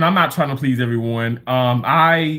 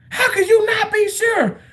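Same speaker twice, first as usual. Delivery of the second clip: much higher, crying shout of disbelief; mock outraged cry. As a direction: shriek it like she's appalled. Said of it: "that fucking woman went off on me".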